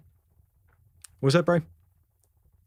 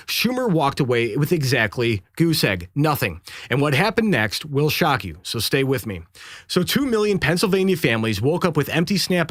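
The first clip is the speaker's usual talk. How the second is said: punchy dramatic YouTube news read; clickbait video narrator; 4 semitones higher than usual. Acted. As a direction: hype every line like breaking news. Reads a dramatic political video script.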